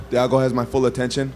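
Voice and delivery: ordinary talk, nothing marked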